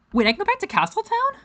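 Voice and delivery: high, fast startled question; surprised. Real, not acted. Surprised at game option.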